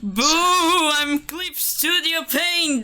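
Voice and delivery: slow, high spooky ghost voice; startling boo. Pretend. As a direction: jump out and spook your friend. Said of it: "Yes, you did scare me".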